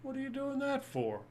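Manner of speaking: high-pitched voice for a talking tree; indignant tree. Acted. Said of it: "the other tree goes".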